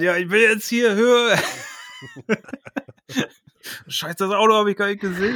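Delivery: high, excited runner calling in mid-run; jogger live on air. Pretend. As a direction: report live from the jogging track. [name] voiced jogging on live stream.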